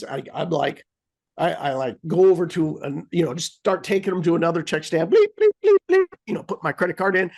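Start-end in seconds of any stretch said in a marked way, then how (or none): 5.1-6.0 high, loud imitated beeping; mock scanner beeps. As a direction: chirp like the checkout machine.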